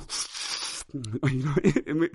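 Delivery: sucking, slithery mouth noise; imitated sliding tatami. Shown as a demonstration. Podcaster tries a tatami sound effect, wet sliding suction noise. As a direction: imitate a straw mat being dragged along. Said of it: "Imitar el sonido de una especie de tatami".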